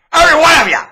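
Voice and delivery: very high, loud yell; explosive high shout; shouted accusation. Real, not acted. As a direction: accuse the whole crowd at full voice. Shouts at congregation.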